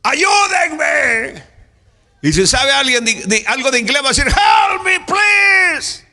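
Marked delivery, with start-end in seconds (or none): 0.0-1.4 high, drawn-out shout for help; a trapped man calling out for help; desperate cry for help. Acted. Preacher acts man in well. 4.3-6.0 drawn-out cry of help me; shouting for help. Acted.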